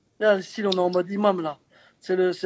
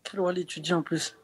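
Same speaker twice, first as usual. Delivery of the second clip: ordinary delivery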